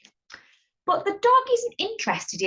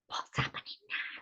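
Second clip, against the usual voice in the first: hushed storyteller's whisper; suspenseful whisper; 13 dB quieter than usual. Pretend. Suspense as tiger story builds.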